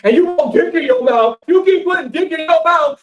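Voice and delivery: high, loud furious outburst; hammed-up rage, loud and high; mock angry yell. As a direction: blow up at them. Imitated man yells insults.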